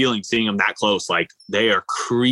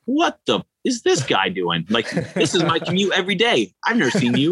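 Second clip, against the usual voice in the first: higher-pitched, lightly voiced indignant turtle; baffled sea turtle; 7 semitones higher than usual. Turtle silently judging a passing swimmer, mock indignation. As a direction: play a put-out turtle commuter. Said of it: "in his head, he's like".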